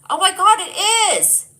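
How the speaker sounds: loud, high excited cry. Real, not acted. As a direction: burst out in delight. Excited realization shouted.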